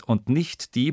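ordinary delivery

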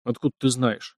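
ordinary delivery, nothing marked

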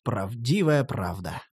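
low, slow and soft mock oath; mock solemn. Master claims mock-solemnly he is truly a prince, low and slow. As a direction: drop low to swear it's true.

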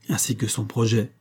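plain reading voice

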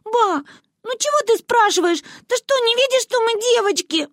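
put-on high, whimpering little girl's plea; whining girl. A narrator's reading. Little Manyunya whining to her grandmother. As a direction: whine it up high like a child. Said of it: "проскулила Манюня".

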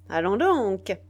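a sharp, impatient outburst of anger. A narrator's reading. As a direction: bark it out, fed up and furious. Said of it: "d'un ton d'impatience et de colère".

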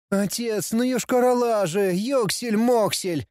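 high, slow whiny grumble; grumbling prince. Acted. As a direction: whine like a dim prince. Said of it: "бубнил принц".

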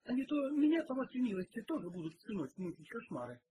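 loud, high burst that settles down; exclaiming. A narrator's reading. Cook complains she will have nightmares, exclaiming. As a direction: scold from a high start, then ease off. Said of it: "воскликнула кухарка".

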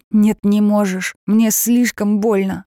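slow, loud wounded refusal; hurt and firm. Acted. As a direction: say it slowly through the pain. Jenny hurt, ends call.

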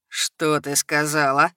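put-on low, slow drunken sneer; lightly voiced sneering drunk; spat-out drunk sneer. A narrator's reading. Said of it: "выплюнул он". (пьяный презрительный плевок словами)

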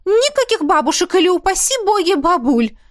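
high, excited chatty mother; mother gushing. Acted. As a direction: gush like a thrilled mother. Mother insisting. (восторженная мама, высокий голос)

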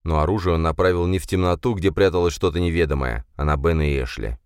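plain reading voice

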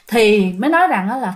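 ordinary delivery

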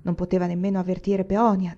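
ordinary delivery, nothing marked

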